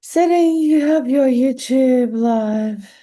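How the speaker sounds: mock robotic assistant voice, slow; Siri voice. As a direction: imitate a phone assistant. Siri voice announcing live stream.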